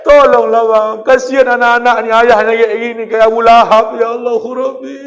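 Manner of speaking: nagging, pleading with Abang; pleading wife. Retold or imitated. Wife pleading with husband to pray. (istri memohon suaminya shalat dengan suara memelas)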